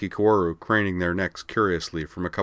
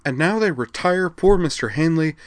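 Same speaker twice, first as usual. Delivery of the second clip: higher, louder aggrieved complaint; indignant unison; 9 semitones higher, 7 dB louder than usual. A narrator's reading. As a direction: protest together in outrage. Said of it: "in eerie unison".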